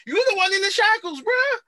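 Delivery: very high-pitched acted disbelief; incredulous mock protest. Host retelling rescuers confronting the man in shackles, high-pitched incredulous protest. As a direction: squeal it in mock indignation. Acts out characters' astonished exchange.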